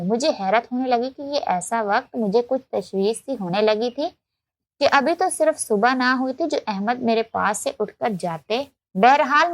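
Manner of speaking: ordinary delivery, nothing marked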